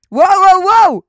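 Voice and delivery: high, very loud run of whoas; shouted whoas. Pretend. Streamer reacts to her chat, high loud shout. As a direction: holler to stop everything.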